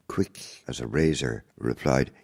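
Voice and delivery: normal talking voice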